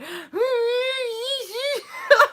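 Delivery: high, tearful laugh; laughing through tears. Real, not acted. Vlogger laughing and crying about the shift, high. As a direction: laugh and cry at once.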